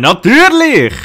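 loud high sing-song yes; mocking yes. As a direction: chirp it like an eager game helper. Mocking tutorial answer.

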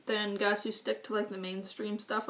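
ordinary talk, nothing marked

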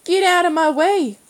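high, loud Cornish snarl; ill-tempered Cornish boy yelling; angry Cornish shout. A narrator's reading. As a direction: bark it furiously in a Cornish accent. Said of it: "the Cornish accent made ugly by anger".

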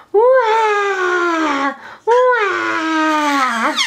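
long pretend baby wails; mock baby cry. Mother asking her child to be a crying baby, playful fake wailing. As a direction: bawl playfully, stretching each "wah". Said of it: "Can you be a crying baby?".

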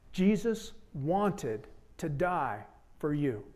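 slow, soft, each word weighed; preacher repeating the key line slowly; slow emphatic repeat. Shown as a demonstration. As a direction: drag it out so it lands. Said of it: "get this".

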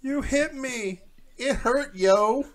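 high, whiny protest; hurt wife. Acted. Slapped wife protesting to husband, high whiny voice.

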